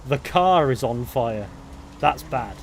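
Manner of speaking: loud, drawn-out announcement of disaster; loud exclaiming. Real, not acted. Player reacting to the car on fire, loud exclaiming. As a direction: belt it out slowly, stretching the key words.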